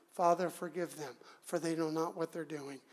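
low, soft murmur from the cross; quiet prayer. Acted. Jesus praying while soldiers gamble, hushed. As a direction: pray under the noise, low and soft.

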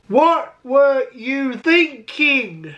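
very slow, word by word; slow scolding. Acted. Vlogger scolding his younger self, very slowly drawn out. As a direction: stretch out each word in mock reproach.

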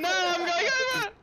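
loud, high panicked yell; panicked shout. Real, not acted. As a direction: shout as the car flips. Car tipping over.

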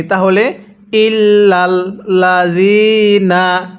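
ইল্লাল্লাযিনা drawn out slowly with doubled lams; slow tajweed reading; slow Quran recitation. Shown as a demonstration. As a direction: recite ইল্লাল্লাযিনা slowly, each lam held. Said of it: "তাহলে কিভাবে পড়ব?".

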